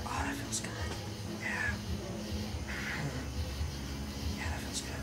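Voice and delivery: soft breathy whisper; barely voiced, intimate; sexy whisper. Shown as a demonstration. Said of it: "sexy voice again".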